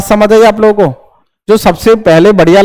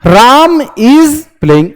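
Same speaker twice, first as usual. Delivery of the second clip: slow trial reading; 3 semitones higher, slower than usual. Shown as a demonstration. Teacher trying the right option aloud for students, slow. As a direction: read it slowly, word by word. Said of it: "चिपका के पढ़ो".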